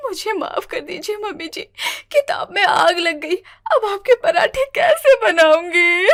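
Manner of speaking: crying apology. Acted. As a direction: beg forgiveness through hammed-up tears. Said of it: "रोने लगती है".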